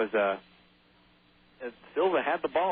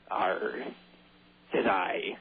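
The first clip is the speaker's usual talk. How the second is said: low pirate growl of "arr"; mock pirate voice; 6 semitones lower, much slower than usual. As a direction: talk like a pirate, pitched low. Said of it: "Pirates, you have to say".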